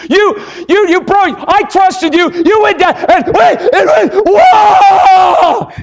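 high, full-voiced enraged father; fly into a hammed-up screaming rage; acted reactionary shouting, ending in a long roar; deafening mock tirade. Shown as a demonstration. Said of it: "I probably deafened you here".

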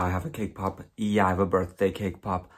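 usual speaking voice

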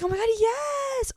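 high-pitched imitation of a delighted squeal; excited fan greeting. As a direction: gush it back sky-high like she did. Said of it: "And she was like".

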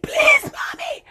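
high breathy whispered begging; whispered plea. Retold or imitated. Girl being pulled by her mother, whispered plea. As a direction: beg in a desperate whisper. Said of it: "I was like".

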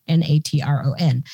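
each letter of N A T R O N said separately and clearly; spelled out letter by letter. Shown as a demonstration. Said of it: "salt called natron".

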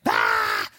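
muffled low scream; into a pillow. Shown as a demonstration. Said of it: "Scream into a pillow".

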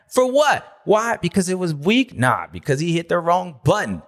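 usual speaking voice

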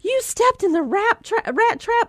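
high, disbelieving question; incredulous mom. Retold or imitated. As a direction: gasp it out in disbelief. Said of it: "And I'm like".

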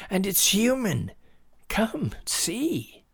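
put-on high-pitched, urgent boy. A narrator's reading. Boy urging his dad to come look, urgent. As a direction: urge them to follow.

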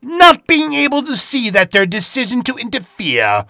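loud, grand showman storyteller voice; dramatic host narration. Acted. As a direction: announce the tale like a theatrical villain host.

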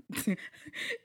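quick airy giggle after tripping on words; breathy laugh at a flub. Real, not acted. Podcast host laughing at her own tongue-twister, airy giggle.

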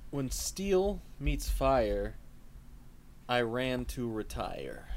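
slow, grave riddle reading; dramatic riddle. Pretend. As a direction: intone the riddle slowly.

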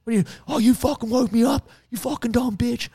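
hammed-up furious bro, pitched high; angry gym bro. Gym bro yelling at the tree crew, mock angry. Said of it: "So she just stepped in like".

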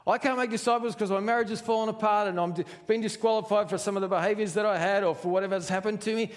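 high, loud, hurried protest; acted flustered self-disqualifying; excuse-making. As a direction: reel off one excuse after another. Acts out another believer's excuse.

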